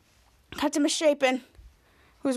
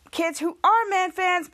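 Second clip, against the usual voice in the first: ordinary talk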